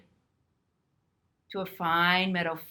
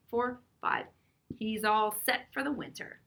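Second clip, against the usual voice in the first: plain reading voice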